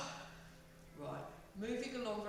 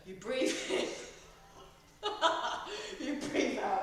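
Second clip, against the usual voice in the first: breath cue broken by real laughter; teacher cracking up mid cue; laughing; 3 semitones higher, 4 dB louder than usual. Real, not acted. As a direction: giggle through the instruction. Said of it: "That's just ruined my anger gains".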